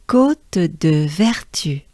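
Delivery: slow, careful "Côte de Vertu", falling at the end; French pronunciation demo. Shown as a demonstration. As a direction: model "Côte de Vertu" slowly for learners. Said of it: "I'll pronounce it first".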